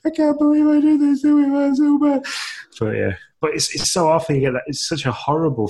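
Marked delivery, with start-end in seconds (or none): 0.1-2.2 high, loud, cracking near-sobbing wail; tearful despair. Retold or imitated. Guilty dad to his wife at night, high tearful wail. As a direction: wail it like you're about to cry. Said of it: "and I'm crying".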